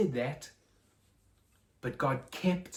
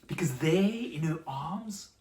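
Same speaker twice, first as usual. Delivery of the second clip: soft, lower, reverent narration; hushed storytelling; 4 semitones lower, 4 dB quieter than usual. A narrator's reading. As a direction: draw the children in quietly.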